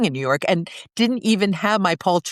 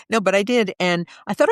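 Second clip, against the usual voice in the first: ordinary talk, nothing marked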